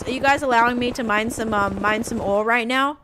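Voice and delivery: ordinary talk, nothing marked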